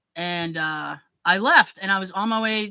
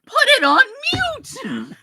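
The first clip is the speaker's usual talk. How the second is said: higher, louder, slow exasperation; exasperated shout; 13 semitones higher, 6 dB louder, slower than usual. Retold or imitated. Daughter to mother about the phone, exasperated shout. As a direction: spell it out in disbelief.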